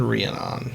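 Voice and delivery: "Rhiannon" ending in a full "on"; alternative pronunciation. Shown as a demonstration. Host tries other way to say "Rhiannon", ending in "on". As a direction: say "Rhiannon" as ree-an-ON, falling. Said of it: "I can't remember".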